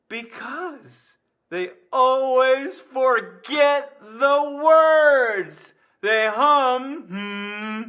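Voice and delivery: high, loud, very drawn-out delivery; gleeful punchline. Pretend. Teacher revealing the hummingbird joke's answer, loud and gleeful. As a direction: belt out the punchline, stretching every word.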